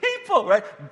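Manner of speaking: high, loud, drawn-out exclamation; mock outrage. Preacher scolding Disney for killing moms, mock outrage. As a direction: explode in slow, high-pitched disbelief.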